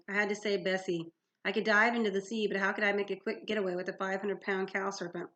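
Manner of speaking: usual speaking voice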